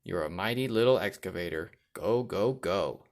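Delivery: ordinary delivery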